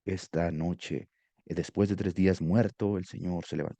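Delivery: low, soft, slow and moving; emotional opening. Shown as a demonstration. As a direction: draw them in quietly with emotion. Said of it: "apelando a la emoción". (apertura emotiva, baja y suave)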